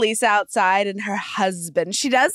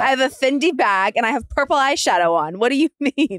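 imitating an affronted, higher-pitched retort; mock indignation; 4 semitones higher, 3 dB louder than usual. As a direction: play it offended and proud of the outfit. Mimics Lisa's indignant retort on show.

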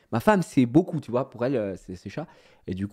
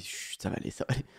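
whispered comfort; soft hush; 12 dB quieter, faster than usual. Retold or imitated. Comforting his crying wife, whispered hush. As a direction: hush her gently.

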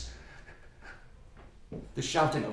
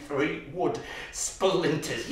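ordinary talk, nothing marked

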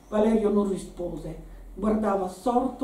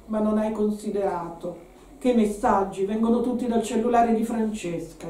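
ordinary delivery, nothing marked

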